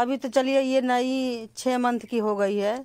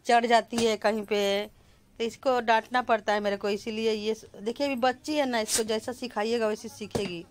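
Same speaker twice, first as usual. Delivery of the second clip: normal talking voice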